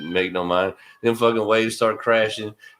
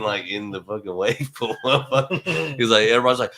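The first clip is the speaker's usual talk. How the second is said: ordinary talk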